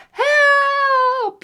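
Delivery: put-on loud, high child's shout; shouted help. Eddie trapped under the bear, shouting. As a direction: yell it for rescue. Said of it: "shouted Eddie".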